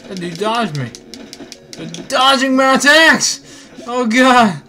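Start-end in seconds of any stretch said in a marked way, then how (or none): 1.7-4.6 frantic exclaiming. Real, not acted. Frustrated in fight.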